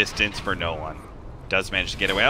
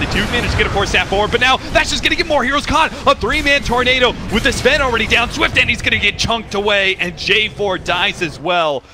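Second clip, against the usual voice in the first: excited call rising high, then easing; live play-by-play; 10 semitones higher, 5 dB louder than usual. Real, not acted. Caster follows heroes getting caught, excited play-by-play. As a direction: drive the commentary through each kill.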